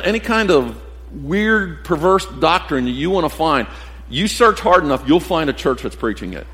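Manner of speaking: ordinary delivery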